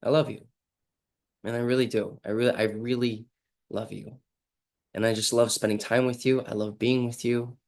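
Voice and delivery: soft, heartfelt "I love you", meaning every word; tender, sincere declaration. Shown as a demonstration. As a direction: say it gently and fully present. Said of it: "you're trying to change the emotion".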